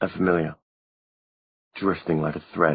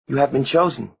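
Maureen; 8 semitones higher than usual. A narrator's reading. Narrator voices Maureen.

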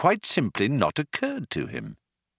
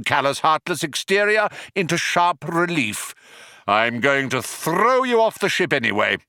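raised, forceful villain tirade; commanding Vogon; 7 semitones higher than usual. A narrator's reading. Vogon ordering prisoners thrown out, harshly commanding. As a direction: pitch it up and shout for the guard.

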